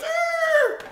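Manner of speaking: loud, high donkey squeal; high animal screech, acted out; imitated donkey cry. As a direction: bray out like a panicked donkey. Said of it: "The donkey goes".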